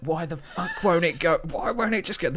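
high, loud frustrated yelling, half laughing; furious swearing. Retold or imitated. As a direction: rant at the pedal that won't go in.

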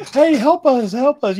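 high, loud calling out; mock cry for help. Characters calling for help in a retold scene, high and loud.

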